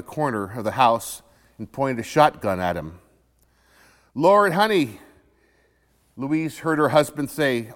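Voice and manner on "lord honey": a higher, louder alarmed call to his wife; retold panicked call for help; alarmed cry. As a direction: cry out in sudden fright. Husband alarmed at gunpoint.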